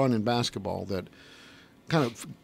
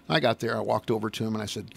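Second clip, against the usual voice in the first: normal talking voice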